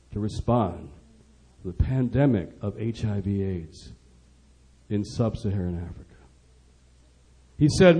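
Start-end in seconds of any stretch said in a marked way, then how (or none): 0.1-6.0 very low and soft, in weighty phrases; grave, hushed plea. Retold or imitated. Rock star urging Washington to respond to the pandemic, grave and hushed. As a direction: drop right down, low and solemn.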